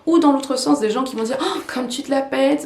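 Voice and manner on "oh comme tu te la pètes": higher-pitched mock sneer at a show-off; mimicked scornful teasing; mocking jibe. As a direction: imitate a critic teasing someone for showing off. Mimics people mocking a good accent.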